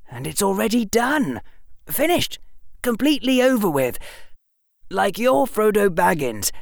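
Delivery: put-on boyish, eager storytelling; young Harry voice. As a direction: voice a clever young boy. Harry's lines in audiobook.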